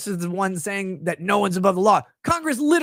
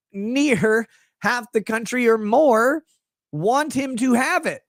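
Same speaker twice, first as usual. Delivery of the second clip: slow, emphatic rebuttal; 2 semitones higher than usual. Retold or imitated. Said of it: "And they're like".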